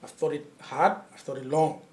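low, hushed and deliberate, with long pauses; deadpan imitation of a grave father; solemn father. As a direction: break it to your son quietly and seriously. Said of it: "he had this seriousness in his face".

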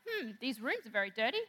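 a mum hinting at chores, pointed and indirect; hinting mum remark. Retold or imitated. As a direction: drop a hint the way mums do. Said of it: "she makes a statement".

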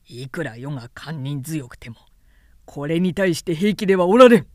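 starts low, bursts into a fast shout; angry, building. A narrator's reading. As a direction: rage rising to an outburst. 曹操 enraged outburst. (低く始めて怒鳴り上げる)

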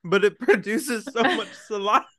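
high, loud laughing talk. Real, not acted. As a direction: laugh through the words. Laughing at own toffee mishap.

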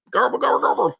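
high babbling crowd noise; crowd gibberish. Retold or imitated. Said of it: "not my imitation of an Indian accent".

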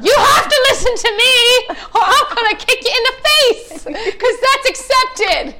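hammed-up high, loud tirade; street ranting. Mocking her own street temper, exaggerated screamed threats. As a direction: screech it like an over-the-top inner-city threat. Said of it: "I get very street".

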